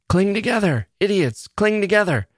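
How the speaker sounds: high-pitched yelled order; urgent high call to the crew; captain's yell. A narrator's reading. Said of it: "the captain yelled".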